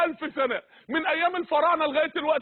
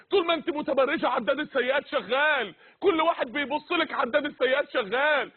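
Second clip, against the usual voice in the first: ordinary talk, nothing marked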